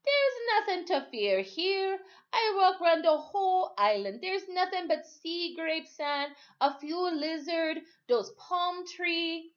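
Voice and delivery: lightly voiced Timothy calming the boy; laughing reassurance. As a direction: soothe the boy, a hint of an old man. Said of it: "He laughed".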